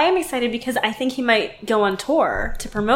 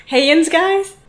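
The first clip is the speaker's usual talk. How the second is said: Pittsburgh "yinz guys", pitched up; Pittsburgh greeting; 6 semitones higher, 4 dB louder, slower than usual. Shown as a demonstration. Repeating it for a puzzled cohost, Pittsburgh accent. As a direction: repeat the Pittsburgh hello, higher. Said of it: "in Pittsburgh, people say yins".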